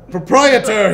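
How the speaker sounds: high, loud barbarian bellow. A narrator's reading. Hrothgar hammers at the potion shop door, bellowing. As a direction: bellow for the shopkeeper. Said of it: "he bellowed".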